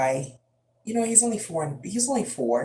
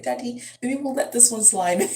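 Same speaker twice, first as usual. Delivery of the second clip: coaxing mom; 8 semitones higher than usual. Retold or imitated. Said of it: "be like".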